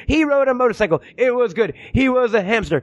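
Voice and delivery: put-on a kid reciting a book review for TV. Mocking his friend as a kid on a kids' show, childlike report. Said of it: "You're like a kid on Reading Rainbow reviewing".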